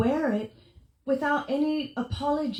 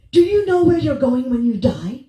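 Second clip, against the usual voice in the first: preachy stranger; 9 dB louder than usual. Retold or imitated. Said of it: "she goes".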